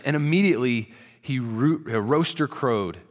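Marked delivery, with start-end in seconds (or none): none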